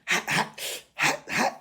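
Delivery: high breathy hissed hot sounds; imitated pained hissing hot. Joe imitating his son's pain behind the door, hissing.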